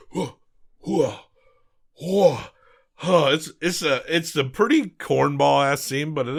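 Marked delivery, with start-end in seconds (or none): 0.1-3.3 higher-pitched mock "huh" grunts; repeated puffs imitating a movie hacker; imitated effort noises. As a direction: act out hand moves with huffs. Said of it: "holding his hands like".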